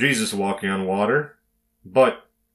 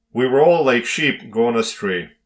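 ordinary delivery

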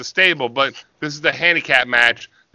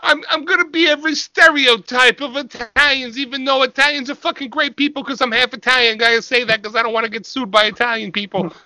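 higher cartoon tough guy, fast in places; 7 semitones higher than usual. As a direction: boast like a clueless wrestler. Staying in Vito character.

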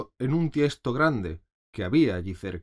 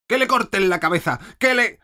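screamed, much higher and louder; furious shout; 10 semitones higher, 8 dB louder than usual. A narrator's reading. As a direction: scream the order in royal rage. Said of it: "se puso a gritar". (grito furioso, muy alto y agudo)

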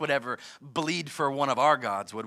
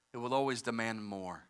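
softer and lower with each repeat; fading warning; 9 semitones lower, 12 dB quieter than usual. Real, not acted. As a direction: let each demand more fade away.

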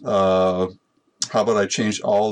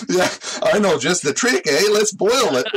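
high hammy yokel voice, eh; 9 semitones higher, 3 dB louder than usual. Retold or imitated. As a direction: play a dopey Canadian-ish woodsman. Voicing syrup discoverer again.

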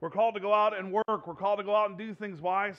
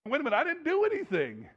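mock protest; 8 semitones higher than usual. Husband protesting.

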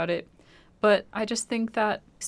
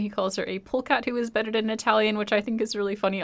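ordinary delivery

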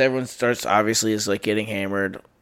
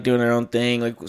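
ordinary delivery

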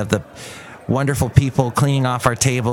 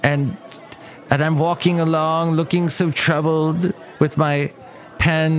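slow, higher-pitched mock poet voice; William Shakespeare; 5 semitones higher, slower than usual. Podcaster voicing his inner playwright, mock-tormented poet. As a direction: draw it out like a hammed-up troubled bard. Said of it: "I have a William Shakespeare that lives in there going".

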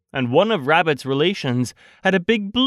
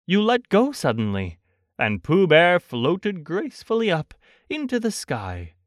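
plain reading voice

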